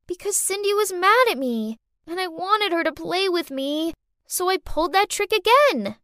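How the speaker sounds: put-on high-pitched child explanation; little girl owning up. A narrator's reading. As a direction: justify yourself as a young child. Child figure in the story.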